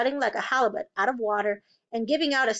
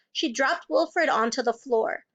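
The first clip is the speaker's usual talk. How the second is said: ordinary delivery, nothing marked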